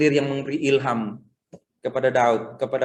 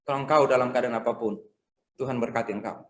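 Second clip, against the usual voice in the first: ordinary talk, nothing marked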